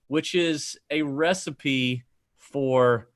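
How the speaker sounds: slow put-on Boston "wicked sweaty balls"; Boston ad read, slowed; mock Boston accent. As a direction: lean into a hammed-up Boston drawl. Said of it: "wasn't bad".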